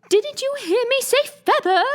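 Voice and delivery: high, loud, cross sheep voice; angry high sheep voice scolding Alice; angry cry. Acted. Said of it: "the sheep cried angrily".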